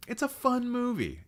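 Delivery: high-pitched defensive squeal; high, comic insistence; playful protest. Real, not acted. Defends a panned film playfully.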